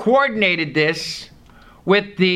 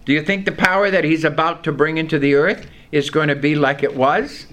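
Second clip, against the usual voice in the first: ordinary talk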